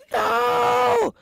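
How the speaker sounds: high, loud screams of terror; terrified scream. Acted. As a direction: scream like facing execution. Victims about to be executed.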